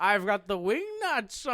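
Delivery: hammed-up Frankenstein. Host playing a monster picking hardware, hammed-up. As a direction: play a silly monster with long vowels. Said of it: "This is Frankenstein".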